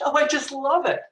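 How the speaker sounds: mock gush with a lift in pitch; retold gushing. Writer to her editor about the illustration, gushing. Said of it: "I just gushed".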